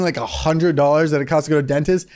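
normal talking voice

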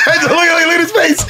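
high laughing exclaim, slowing. Real, not acted. Laughing at the storyteller's delighted face. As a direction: laugh and point it out.

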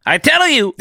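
high loud fake Italian; Italian accent. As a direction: join the Italian bit loudly. Joins the Italian voice.

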